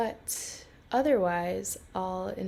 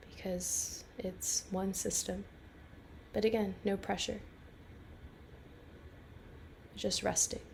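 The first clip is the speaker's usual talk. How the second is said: calm, unhurried guiding voice with long pauses; guided meditation. Real, not acted. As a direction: lead a listener gently into rest, phrase by phrase.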